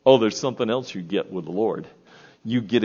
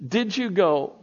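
normal talking voice

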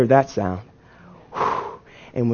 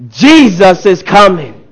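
slow, emphatic proclamation; rousing preacher's cry, words stretched out; as if seeing him coming; 4 semitones higher, 4 dB louder, slower than usual. Shown as a demonstration. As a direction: proclaim it like he's arriving right now. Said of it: "Say it like you see him coming".

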